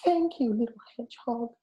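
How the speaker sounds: very high, grateful little squeak; squeaky mice. A narrator's reading. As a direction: squeak thanks like tiny mice. Mice thanking hedgehog.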